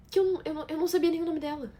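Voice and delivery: high, stumbling disbelief; dismayed realization. Acted. Wife realizing she forgot the woman's name, dismayed. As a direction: let it jump up high as it dawns on you.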